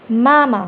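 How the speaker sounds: "मामा" said loud with a stretched "aa"; long vowel. Shown as a demonstration. Teacher demonstrates the long vowel sound, stretched "aa". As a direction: draw out the "aa" in "मामा", firmly. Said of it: "वो ज़्यादा लंबा खींचता".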